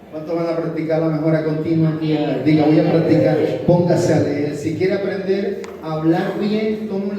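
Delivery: loud, driving sermon delivery; rallying the congregation at full voice; fiery preaching. Acted. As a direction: preach it out to the whole church.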